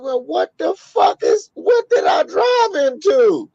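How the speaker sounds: very high, wailing mock panic; acted shock. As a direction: squeal it like a horrified driver. Acts out shocked driver.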